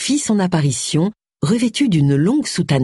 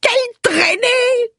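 much higher, slow tearful wail; plaintive; much slower than usual. A narrator's reading. Millicent, ignored by the bishop, wails plaintively. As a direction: cry it out, hurt and jealous. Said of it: "d'un ton plaintif".

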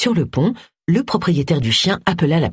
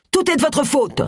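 angry shout; 10 semitones higher than usual. Acted. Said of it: "cria-t-il".

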